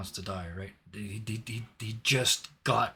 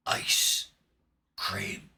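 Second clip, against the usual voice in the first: dying gasp; 3 dB louder than usual. Acted. Doctor's dying last words.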